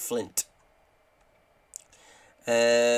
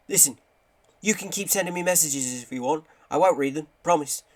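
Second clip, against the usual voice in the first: higher-pitched, badly faked character voice; hammed-up, higher voice of a caller; terrible Graham; 4 semitones higher than usual. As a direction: do a bad impression, pitched up. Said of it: "terrible Graham impression".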